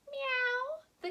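very high, falling meow; cat meow. Acted. Showing children the cat's sound, meowing. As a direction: mew high like a little cat. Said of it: "she makes a sound like this".

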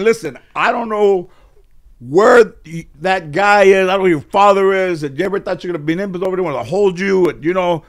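loud, high-pitched acted tirade; angry rant. Angry relative telling a child about his absent parents, ranting. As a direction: lay into the kid at full volume. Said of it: "things that were said in anger".

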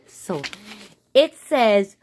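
ordinary talk, nothing marked

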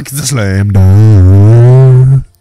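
huge drawn-out dunk yell; very loud, elongated victory shout; crazy celebration. Real, not acted. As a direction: go crazy celebrating, stretching the words out long. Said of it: "I'm just gonna go crazy".

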